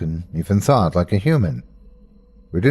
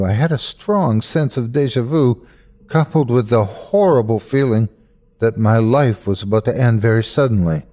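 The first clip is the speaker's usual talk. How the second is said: ordinary delivery, nothing marked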